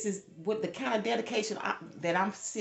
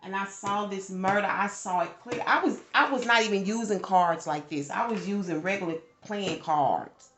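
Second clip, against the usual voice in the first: loud, rising, agitated retelling; building up, louder with each line; heated storytelling at full volume; worked up; 3 semitones higher, 11 dB louder than usual. Real, not acted. Reliving a foreseen murder.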